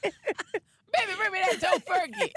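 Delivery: high, loud mock order to a partner; playful high-pitched demand; mock diva demand. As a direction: call out for the tofurkey like a spoiled spouse. Joking mock request for tofurkey.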